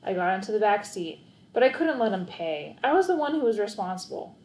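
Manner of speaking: ordinary delivery, nothing marked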